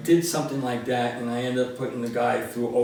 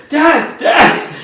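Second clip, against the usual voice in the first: much higher, louder frantic calls for his father; calling out to dad; 12 semitones higher, 11 dB louder than usual. Retold or imitated. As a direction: shout for your dad as you dig him out. Son calling fallen father.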